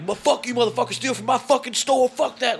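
high furious cursing; angry yell. Retold or imitated. Breathless Taylor cursing the thief, angry high yell. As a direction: spit the curses at him. Enraged store owner at thief.

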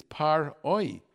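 Par-Oi slowly, split before Oi; Par-Oi drawn out with the ayin break; correct pronunciation. Shown as a demonstration. Rabbi teaching the proper reading, Par-Oi slow. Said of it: "the ayin over there".

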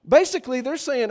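ordinary delivery

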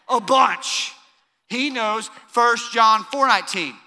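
normal talking voice

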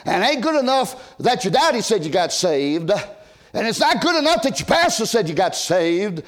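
usual speaking voice

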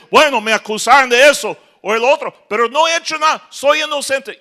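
high, loud protest; pleading prisoner. Acted. Prisoner tells the duke he's innocent, pitched high and loud. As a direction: insist you did nothing.

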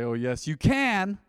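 slow down and land hard on can; stress on can. Shown as a demonstration. Preacher demonstrating stressed syllables to the congregation, can stressed. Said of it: "stress certain words and syllables".

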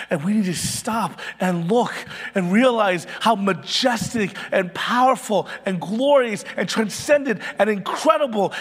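loud, rising, impassioned sermon delivery, peaking in a shout; full-force preaching. Real, not acted. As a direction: preach it like you're calling the congregation to awe.

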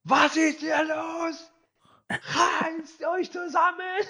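high, slow mock shout; [name] coming downstairs, shouting at the fighters. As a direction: yell at them to pull themselves together. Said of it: "hat geschrien".

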